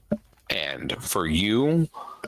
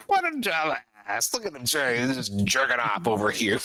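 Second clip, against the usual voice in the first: mocking spider; 3 semitones higher than usual. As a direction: sneer at him to a friend. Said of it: "and say".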